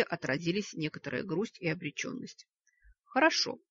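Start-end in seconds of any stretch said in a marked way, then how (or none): 3.0-3.6 ingratiating. Acted. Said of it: "вкрадчиво произнёс он".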